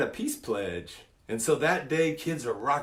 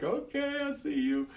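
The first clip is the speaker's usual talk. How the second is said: much higher, amused "I see you"; teasing approval; 13 semitones higher, 4 dB quieter than usual. Retold or imitated. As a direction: tease him, impressed. Impressed by kid's answer.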